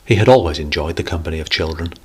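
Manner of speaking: ordinary delivery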